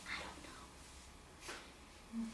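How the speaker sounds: slow, breathless whisper. Acted. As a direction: whisper it, barely there. Child answers in despair.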